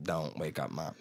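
low, soft careful murmur; hushed, keeping the house asleep; tiptoeing hush. Retold or imitated. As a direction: say it as if sneaking past a sleeper. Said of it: "I tiptoe".

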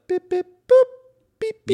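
high beeping robot toy. Retold or imitated. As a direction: chirp each beep very high like a toy. Mimicking beeps of dialed numbers.

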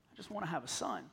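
very soft, low acted heartbreak; small, defeated prayer; anguished plea. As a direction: let the plea sink to a hush. Hannah's plea closes her prayer.

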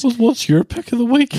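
laughing. Real, not acted. Laughing change of subject.

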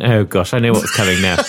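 normal talking voice

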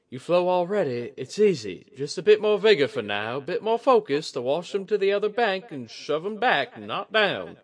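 high, loud character voice, encouraging; coaxing woman. Acted. Woman urging the river spirit to push, coaxing. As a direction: coax the river spirit along.

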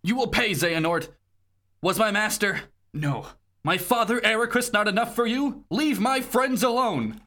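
high, dramatic hero outrage; acted anger. Terra defying Xehanort to protect friends, acted anger.

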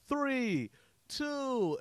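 high sing-song countdown call; teasing countdown call from the stands; mock countdown. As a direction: call out the seconds high to trick him. Counting down clock to fool player.

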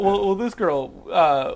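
ordinary delivery

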